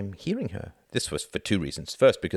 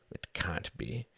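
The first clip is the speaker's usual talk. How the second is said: stunned murmur; 11 dB quieter, much slower than usual. Acted. Reginald stunned by news.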